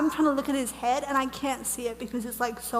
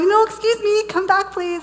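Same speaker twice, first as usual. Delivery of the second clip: high, loud, urgent calling out; pleading call; 8 semitones higher, 8 dB louder than usual. Retold or imitated. Retelling calling the angel back as he leaves, pleading call. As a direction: call after someone walking away. Calls after departing angel.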